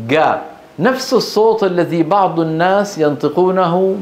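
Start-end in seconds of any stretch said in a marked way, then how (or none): 0.1-0.4 جا said as ga; jim as hard g. Shown as a demonstration. Teacher shows the fifth mistake, jim as g. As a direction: replace the j of جا with a hard g. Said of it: "نطقها مثل".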